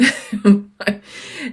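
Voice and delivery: tearful laugh. Real, not acted. Laughing at the emotional wedding.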